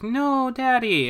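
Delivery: put-on high, slow child's plea; mock little girl. Daughter calling to her father, high slow childlike plea. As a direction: stretch it out like a scared kid. Daughter voiced as she's taken.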